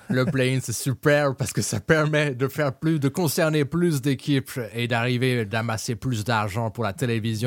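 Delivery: put-on French with a hammy American accent. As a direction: play it like a US TV pitch, American accent. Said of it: "avec un, un accent américain". (accent américain exagéré)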